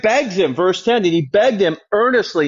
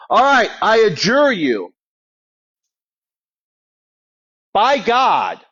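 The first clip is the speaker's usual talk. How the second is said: a lightly raised, louder demon voice; demon's plea; 4 semitones higher, 3 dB louder than usual. Acted. Preacher voicing the demon to Jesus, mock pleading. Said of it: "So he says".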